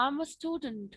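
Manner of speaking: slow, stalling, um-filled answer; halting with pauses. Shown as a demonstration. Student nervously answering the examiner, slow and halting. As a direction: stretch the words as if thinking hard. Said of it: "थोड़ा सा आप pause ले लेते हो".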